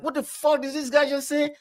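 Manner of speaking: high, rushing panic in his own head; suddenly sure something is badly wrong; panicked disbelief. Friend retelling his reaction to the call, high panicked disbelief.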